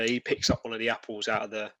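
normal talking voice

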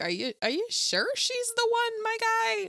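high-pitched, hesitant questioning; incredulous doubt. Retold or imitated. Advising the love interest about his choice, high incredulous doubt.

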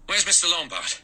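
high-pitched, mildly breathless question. A narrator's reading. Blore back with the rope, mildly breathless. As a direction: ask urgently, a touch out of breath. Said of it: "Blore said breathlessly".

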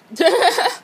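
a high burst of laughter with a cry in it; exasperated laugh. Real, not acted. Laughing off her own rant to viewers, high and exasperated. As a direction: laugh it off, half-frustrated.

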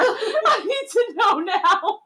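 slow, high, through laughter; laughing hard. Real, not acted. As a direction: squeeze it out while cracking up. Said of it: "Oh, my stomach hurts".

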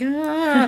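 high, held-back imitation cry; small high "ah" of hammy horror; mock TV-movie scream. Boy in a TV movie, hand on face, small mock scream. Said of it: "It's proper T V movie".

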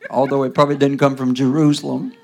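loud opening preach; full-force preaching. Acted. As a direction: preach it out over the room.

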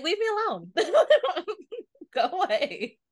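high, laughing through the words. Real, not acted. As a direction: crack up mid-line. Laughing while acting it out.

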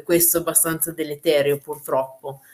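ordinary delivery

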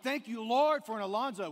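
loud, high thankful cry; exclamation of thanks. Real, not acted. Pastor thanking God aloud for Alonzo, loud and joyful. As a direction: burst out in gratitude to God.

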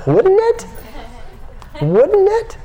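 giddy high-pitched wouldn't it; high playful squeal. Real, not acted. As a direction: tease them with a giddy high rise. Teasing the audience, high squeal.

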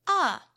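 short, loud cry of alarm; panicked yelp. A narrator's reading. Tokomon sees the Digivice thrown, panicked yelp. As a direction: gasp out a startled "ah". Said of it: "In a panic".

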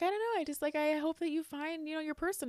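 high, hedging mock concern; imitating concerned relative. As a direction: imitate him awkwardly explaining his worry. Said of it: "why do you sound so concerned".

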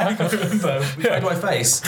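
laughing through a mock panicked question. Panicked cover, laughing at herself.

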